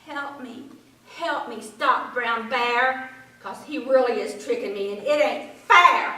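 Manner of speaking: rabbit pleading. A narrator's reading. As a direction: beg Frog for help.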